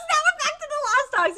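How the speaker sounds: high excited shout; excited outburst. Real, not acted. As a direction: blurt it out in excited realisation. Excited reaction to manga.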